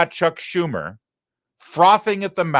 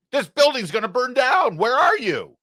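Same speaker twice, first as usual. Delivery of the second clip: mock panic; 9 semitones higher than usual. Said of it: "he set fire to a building".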